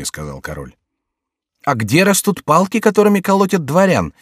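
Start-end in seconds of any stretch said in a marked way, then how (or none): 1.6-4.1 cheeky retort. A narrator's reading. Jester retorts to king's threat.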